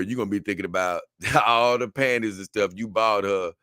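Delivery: normal talking voice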